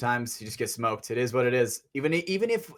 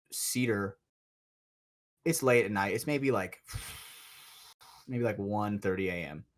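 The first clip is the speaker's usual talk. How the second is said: ordinary delivery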